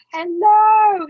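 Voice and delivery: high, loud delighted hello; excited greeting. Real, not acted. Host greeting a New Zealand viewer, excited hello. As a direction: greet a faraway viewer with a bright burst.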